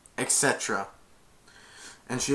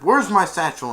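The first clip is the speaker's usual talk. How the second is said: demanding; 7 semitones higher, 8 dB louder than usual. Acted. Flynn demands satchel.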